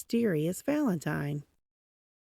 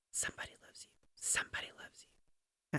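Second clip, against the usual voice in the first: breathy unvoiced whisper, much softer; 17 dB quieter than usual. A narrator's reading. Dust cloth whispering to Mister Hatch, eerie whisper. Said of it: "the dust cloth seemed to whisper".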